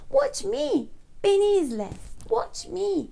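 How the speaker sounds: high-pitched storybook call; eager character voice. Acted. Peppa showing Richard how, high eager character voice. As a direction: call for attention in a high little voice.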